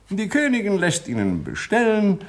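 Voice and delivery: ordinary delivery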